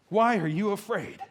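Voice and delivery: mildly irritated rebuke; ticked. Acted. Jesus responds to frightened disciples, mildly ticked. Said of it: "And I think he's ticked".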